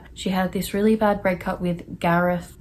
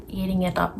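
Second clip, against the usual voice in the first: ordinary delivery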